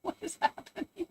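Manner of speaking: high, bewildered retold panic; imitating a freaked-out self; retold alarm. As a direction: replay the confusion of the moment. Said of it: "I was like".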